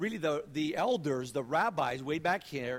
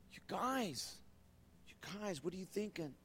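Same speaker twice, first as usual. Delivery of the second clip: dismayed hush; 4 semitones higher, 8 dB quieter than usual. Acted. Voicing Jesus, dismayed.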